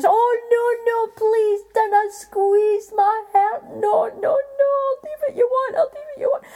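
a giant begging for his life; pleading giant. A narrator's reading. Giant begging Jack not to squeeze his heart, high, loud and crying.

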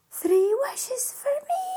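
high, loud astonished question; amazed. Acted. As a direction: ask in sheer disbelief. Jack amazed.

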